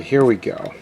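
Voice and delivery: ordinary delivery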